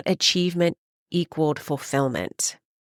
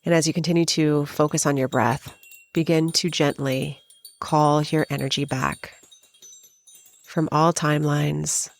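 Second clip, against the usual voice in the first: spacious, even meditation voice with long pauses; guided meditation. Acted. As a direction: guide the listener gently inward, phrase by phrase.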